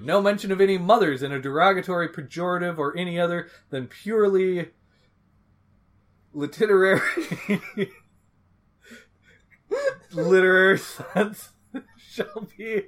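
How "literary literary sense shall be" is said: laughing. Real, not acted. Laughing through a read rule.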